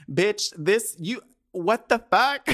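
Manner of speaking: high, loud, sputtering outrage; exasperated disbelief. Retold or imitated. Woman rants at her absent date's laziness, high sputtering exasperation. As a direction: lose the words, then burst out incredulous.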